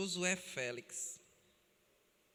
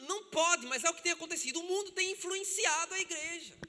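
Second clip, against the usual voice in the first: higher, impassioned pulpit voice; preaching at full force; 7 semitones higher than usual. Acted. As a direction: lament the broken families to the church. Preacher exhorting the congregation.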